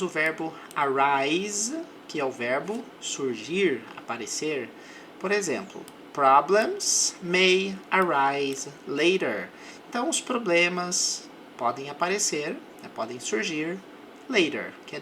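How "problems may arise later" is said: slow, careful English model sentence; deliberate teacher pace; slow example read. Shown as a demonstration. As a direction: read it slowly for students to repeat. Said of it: "English example read slowly".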